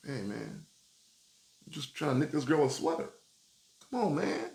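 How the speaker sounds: a hint of a soft hurt knitter; wounded protest. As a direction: plead softly, wounded by the insult.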